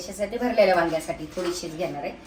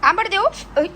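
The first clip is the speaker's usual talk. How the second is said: teasing offer; 13 semitones higher, 6 dB louder than usual. Real, not acted. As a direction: pitch it way up for the little one. Offering sour mango to toddler. (लाडाने, उंच आवाजात विचारत)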